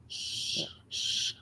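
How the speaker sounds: soft, unvoiced shushing; imitated hushing. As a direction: hush twice, drawn out and low in volume. Imitated noise of branch and hushing.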